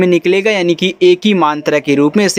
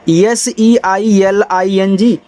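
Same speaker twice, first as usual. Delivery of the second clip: slow letter-by-letter spelling; much slower than usual. Shown as a demonstration. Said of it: "इसको हम यहाँ पे पढ़ते हैं सीलिंग".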